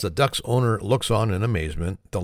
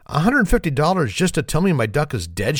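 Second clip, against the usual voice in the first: high-pitched, outraged question without full shouting; mock yell; 7 semitones higher than usual. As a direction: exclaim like an indignant customer. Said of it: "she yells".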